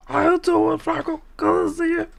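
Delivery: very high, silly character voice; put-on high voice. Streamer talking to viewer Franco, high silly voice. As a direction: squeak it out in a put-on voice.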